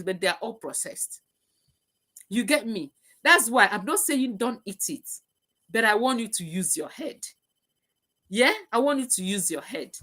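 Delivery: ordinary talk, nothing marked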